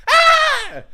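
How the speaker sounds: put-on sudden shrill high kid scream; child's scream. Showing how kids always scream, piercing child's shriek.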